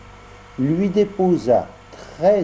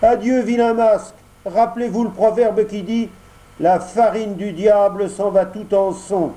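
high, louder puppet voice, scornful; Pinocchio refusing; 8 semitones higher, 3 dB louder than usual. Acted. Pinocchio scornfully rebuffs the fox and cat. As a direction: send the swindlers packing.

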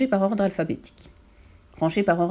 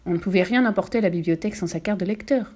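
ordinary delivery, nothing marked

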